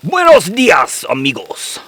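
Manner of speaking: hearty Spanish buenos dias; mock Spanish greeting. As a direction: greet like a Mexican host, hammed up. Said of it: "Spanish greeting performed".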